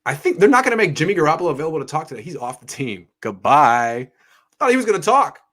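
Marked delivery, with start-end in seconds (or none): 3.2-5.4 surprised. Real, not acted. To chat about Jimmy leaving, loud surprised disbelief. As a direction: blurt it out in disbelief.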